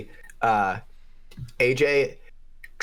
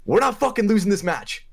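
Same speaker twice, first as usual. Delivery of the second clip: retold rallying shout; 10 semitones higher, 3 dB louder than usual. Bloodied player rallying teammates.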